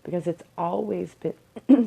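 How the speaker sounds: crackly, catching voice, drawn out; slow words with a crackle in them. Real, not acted. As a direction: let the voice crackle before clearing the throat. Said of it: "my voice is crackly".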